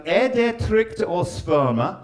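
slow, over-careful "Er det trygt å svømme?"; careful Norwegian attempt. Shown as a demonstration. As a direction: sound out each Norwegian word deliberately. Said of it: "Two phrases I learned".

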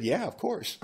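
high, laughing easy agreement; lightly voiced amused wife; wife's laughing reply.

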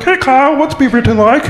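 odd asker voice. Retold or imitated. People ask Kyle about his town, odd put-on voice. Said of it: "Why do you sound like that?".

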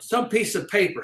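normal talking voice

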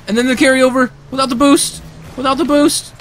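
high, loud excited call; hyped call. Real, not acted. Gamer hyping his own goal on stream. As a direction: shout it like a highlight.

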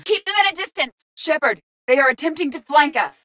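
high-pitched battle callout; urgent tactical warning. Acted. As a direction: warn the squad mid-fight.

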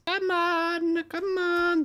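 high, thin plea; strained pleading. Real, not acted. Pleading with the moving block.

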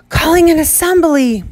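high, loud breathless call; breathless loud summons; gasping call. Acted. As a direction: gasp it out as you call. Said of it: "His first words were a gasp".

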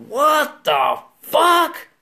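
high, loud, drawn-out astonishment; stunned disbelief. Acted. Friend hears Danger likes someone, stunned disbelief. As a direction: stretch each word out in slow disbelief.

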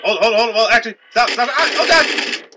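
loud, high, climbing panic; frantic shouting. Real, not acted. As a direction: yell for a halt, rising to a cry. Frantic as game goes wrong.

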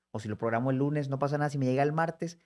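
normal talking voice

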